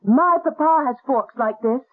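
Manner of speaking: high-pitched exclamation; crying out. Acted. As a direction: cry it out as the boy did. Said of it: "he cried out".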